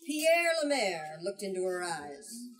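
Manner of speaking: ordinary delivery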